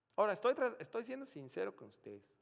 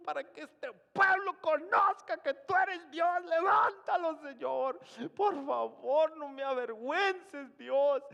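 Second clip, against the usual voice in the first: much higher, much louder, pleading to God; desperate shouted prayer; 13 semitones higher, 12 dB louder than usual. Retold or imitated. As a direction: beg God at full voice not to be shamed. Shouted prayer to raise the dead. (súplica a gritos, mucho más alta)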